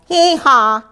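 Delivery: high two-note hee-haw; donkey bray. A narrator's reading. Donkey waking the stable, high bray. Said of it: "brayed Donkey".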